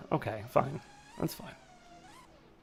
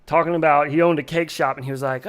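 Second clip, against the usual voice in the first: usual speaking voice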